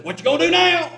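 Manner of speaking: loud, high, gravelly old preacher imitation; Brother Miller. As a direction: belt it out in a gravelly mimicked voice. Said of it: "I do a fair imitation of him".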